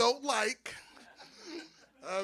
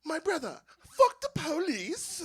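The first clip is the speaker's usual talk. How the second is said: higher-pitched mock British accent; 6 semitones higher, slower than usual. Imagined dragon rider cursing the police, British accent. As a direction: put on a slow, raised British voice. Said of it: "in his British accent".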